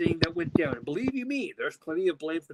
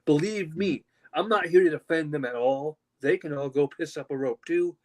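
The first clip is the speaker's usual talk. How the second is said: usual speaking voice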